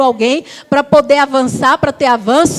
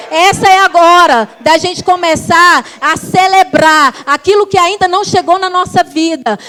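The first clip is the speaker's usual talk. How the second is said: building louder preaching cadence; 3 semitones higher, 5 dB louder than usual. Real, not acted. Pastor exhorting the church, building to full force.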